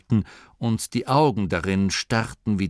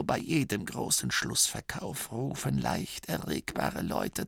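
put-on condescending old abbot; 4 semitones higher, 6 dB quieter than usual. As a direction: lecture him gently from great age.

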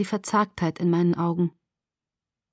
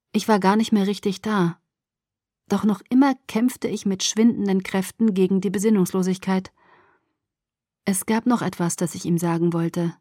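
ordinary delivery

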